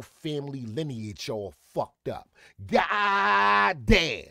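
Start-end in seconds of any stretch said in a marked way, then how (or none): 2.7-4.2 high, drawn-out cry of disbelief; exclamatory shout. Real, not acted. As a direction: belt it out, stretching the first word. Exclaims in mock outrage.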